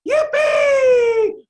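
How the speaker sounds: mock glee. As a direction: squeal it like a thrilled child, hammed-up. Mock joy about brushing teeth.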